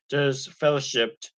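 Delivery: ordinary delivery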